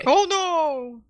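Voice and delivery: high, loud mock-horrified cry; hammed-up alarm at a feeble scheme; mock dismay. Host reacting to the switched call sheets, mock dismay.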